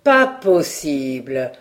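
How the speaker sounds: low, slow mock disbelief; affecting a smile. A narrator's reading. Reporter brushes off the threat, feigning smiling disbelief. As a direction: drawl it low with a put-on smile. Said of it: "en affectant de sourire".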